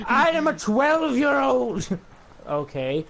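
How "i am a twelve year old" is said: put-on higher and louder, a whiny kid insisting; mock childish outburst. Mocking an adult who claims to be twelve, in a higher, louder whine. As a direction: squeal it like a bratty child.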